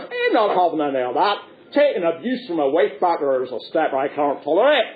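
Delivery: indignant crocodile in broad Ulster; Ulster accent. Acted. Crocodile won't take abuse from Punch, Ulster accent. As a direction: object firmly in an Ulster accent. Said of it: "configure the crocodile with an Ulster accent".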